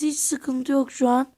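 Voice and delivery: ordinary delivery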